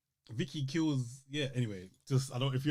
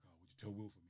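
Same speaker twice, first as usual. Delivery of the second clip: soft request; 6 semitones lower, 19 dB quieter, faster than usual. Retold or imitated. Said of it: "So he's all like".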